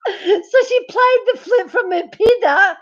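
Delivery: words squeezed out through laughter; high-pitched laughing, voice breaking; laughing hard. Real, not acted. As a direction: crack up while saying it. Laughing at band camp joke.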